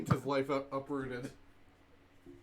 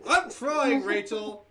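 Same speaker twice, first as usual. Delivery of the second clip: high, loud exclamation; exasperated protest; 5 semitones higher, 7 dB louder, slower than usual. Real, not acted. Player answering a friend at the table, exasperated protest. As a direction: protest loudly, pitched up.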